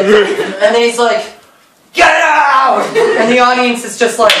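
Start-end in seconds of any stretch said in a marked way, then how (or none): 1.9-2.8 high, drawn-out mock yell; mock shout. Joe throwing Orlick out, mock shout. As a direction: holler it as a hammed-up order.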